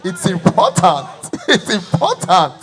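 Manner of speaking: slow, laughing through the words; amused speaker repeating the line while laughing. Real, not acted. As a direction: chuckle as you repeat it.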